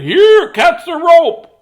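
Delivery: high, loud, drawn-out call; shouting from up high. A narrator's reading. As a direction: holler it down to someone far below. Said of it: "someone up high shouted".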